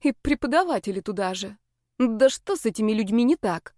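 ordinary delivery

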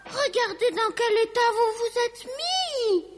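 high-pitched indignant holler; scolding yell. A narrator's reading. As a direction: scold naughty bears in a high wail. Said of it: "hurla Lucie".

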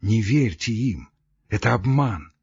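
usual speaking voice

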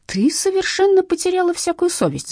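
high-pitched outraged scolding; husband's restrained outburst; mild angry yell. A narrator's reading. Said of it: "заорал он".

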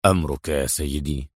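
low, soft, tight compliance; obey grudgingly through gritted teeth; deep, restrained voice swallowing the protest. A narrator's reading. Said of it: "ضغط أدهم على أسنانه وقال".

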